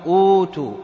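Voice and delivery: long smooth "ootoo" for أوتوا; hamza turned waw. Shown as a demonstration. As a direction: hold the opening vowel of أوتوا, no catch. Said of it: "مد وهو الواو".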